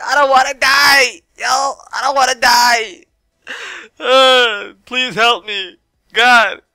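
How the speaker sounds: high, loud, frantic mock wail of terror; panicked shout. Hiding from a stranger, pleading in a loud panicked shout. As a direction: shriek it like you're about to die.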